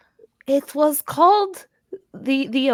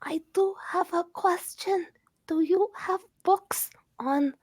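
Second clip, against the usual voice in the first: whispered question; library whisper; 3 semitones higher, 7 dB quieter than usual. Acted. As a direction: whisper so the library stays quiet. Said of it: "I will be quiet".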